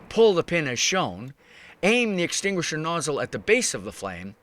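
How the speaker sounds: ordinary delivery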